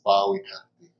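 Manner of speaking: "Bawikati" said as the original name; original pronunciation. Shown as a demonstration. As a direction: show how "Bawikati" is originally pronounced, ba-wi-ka-ti. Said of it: "originally it's pronounced".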